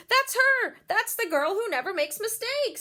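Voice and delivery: high, loud thrilled exclaim; excited fan. A narrator's reading. Woman in the audience spotting Beatrice, excited. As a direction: point her out with delight. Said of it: "said a woman".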